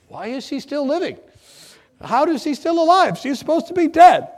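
high, rising to loud disbelief; incredulous outcry. Retold or imitated. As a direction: demand why she's still alive, astonished. Asking nurses, shocked she's alive.

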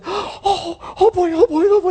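put-on a child thrilled beyond words; excited kid. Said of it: "And the kid goes".

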